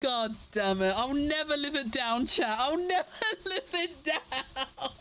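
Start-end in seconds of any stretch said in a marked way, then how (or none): 2.6-4.9 slow, words breaking into laughter; laughing despair. Real, not acted. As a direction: lament it while cracking up. Laughing at own lasting embarrassment.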